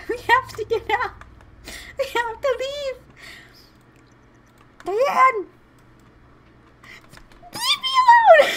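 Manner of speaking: very high, loud squeal building to a scream; frantic shouting. Acted. Player voicing a character desperate to get away, frantic shout. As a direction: shriek to be left alone, hammed-up panic.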